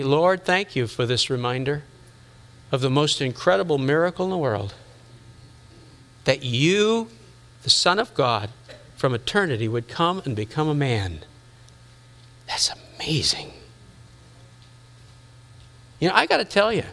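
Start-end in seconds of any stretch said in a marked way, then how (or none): 0.0-11.2 soft, reverent thanks to God; grateful prayer. Retold or imitated. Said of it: "Or you can say". 12.5-13.5 whispered in wonder; awed whisper. Real, not acted. Awed aside after prayer.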